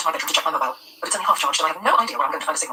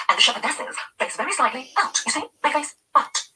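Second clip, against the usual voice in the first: slow, over-clear explaining; 9 semitones higher, slower than usual. A narrator's reading. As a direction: talk down as to a toddler. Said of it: "She's speaking slowly and clearly".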